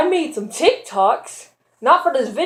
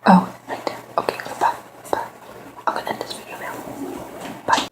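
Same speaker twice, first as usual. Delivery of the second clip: whispering; 4 semitones lower, 5 dB quieter, faster than usual. Real, not acted. Quietly signing off.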